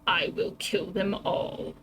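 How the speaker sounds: slow, hushed, whispery villain threat; a cruel king breathing threats close to the ear; menacing king. Acted.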